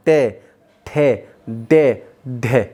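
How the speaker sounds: टै, ठे, डे, ढे drawn out slowly; slow syllable drill. Shown as a demonstration. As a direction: sound out टै, ठे, डे, ढे slowly. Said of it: "उन वर्ण को बोलिए". (धीरे धीरे टै, ठे, डे, ढे)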